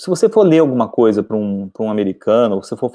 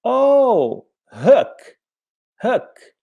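Hook with a short vowel, sliding high as in "huck"; cashier's realization; 11 semitones higher, much slower than usual. Retold or imitated. Cashier finally understanding the tourist, high-pitched realization. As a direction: she finally gets it: oh, Hook!. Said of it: "ela falou assim".

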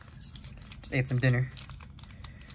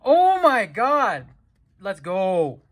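excited shout; 5 semitones higher, 4 dB louder, much slower than usual. Real, not acted. Hits a big card.

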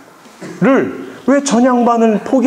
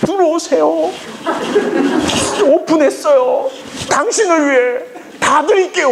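higher, bright welcoming call of a shopkeeper; inviting shop voice; 11 semitones higher than usual. Pretend. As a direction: lure them in like an eager shop owner. (손님을 맞는 가게 주인처럼 높은 목소리로)

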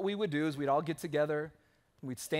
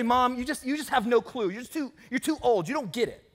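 higher, louder condescending brush-off; dismissive to mom; 5 semitones higher, 4 dB louder than usual. Pretend. Pastor mimicking a child belittling his mom, high and mildly scornful. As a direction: talk down to her as if she's hopelessly out of touch. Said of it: "You don't say".

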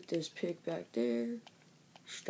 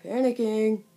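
loud, higher panicked cry; panicking; 4 semitones higher, 11 dB louder than usual. Real, not acted. Gamer to viewers while cornered by zombies: loud panicked call. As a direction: blurt it out as mobs close in. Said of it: "I'm in panic mode".

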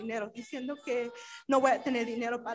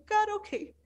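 angry; 8 semitones higher than usual. Retold or imitated. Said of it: "Yo me enojé y dije".

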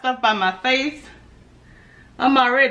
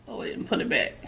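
muttered whisper; 10 semitones lower, 9 dB quieter, faster than usual. Real, not acted. Muttering to herself.

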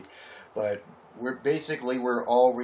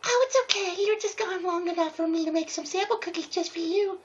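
high squeaky pony voice throughout; cheerful cartoon pony reassuring a friend; hammed-up high falsetto pony; Epic Bloom character voice. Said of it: "Epic Bloom replied".

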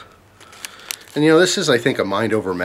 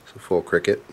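usual speaking voice

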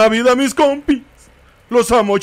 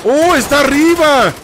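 surprised exclamation; 9 semitones higher than usual. Real, not acted. Spots enemy above.

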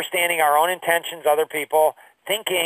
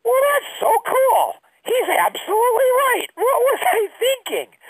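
mock naive awe. Younger self quoting the advisor, in a mock awed high voice. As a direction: play your younger self gushing, way up high.

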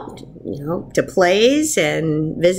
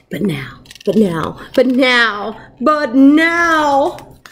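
each but now louder and higher than the last; building repetition; 3 semitones higher, 3 dB louder, much slower than usual. Acted. Repeated phrase building up.

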